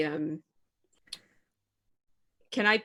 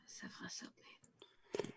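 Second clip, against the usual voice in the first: barely audible whisper for help; whispered plea; 20 dB quieter, faster than usual. Acted. Trapped wizard begs Severus for help, whispered plea. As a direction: whisper it, far softer than usual. Stranded prisoner pleads for help.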